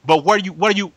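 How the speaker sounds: ordinary talk, nothing marked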